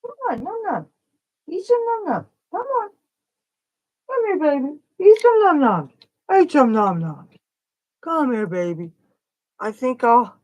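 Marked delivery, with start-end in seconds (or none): 0.0-8.9 high sing-song coaxing, much higher. Real, not acted. Offering a baby bird food, high sing-song baby talk.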